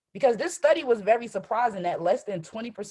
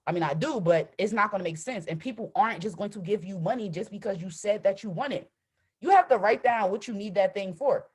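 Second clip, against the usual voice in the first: ordinary delivery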